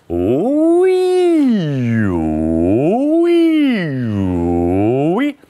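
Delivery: sweeping falling and rising ooh-wee; exaggerated cartoon intonation. Shown as a demonstration. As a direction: slide down and up like a cartoon. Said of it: "Relax your throat totally and go".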